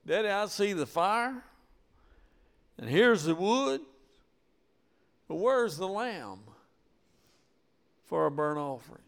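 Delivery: lightly voiced boy, puzzled about the lamb; child Isaac asking. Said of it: "He said".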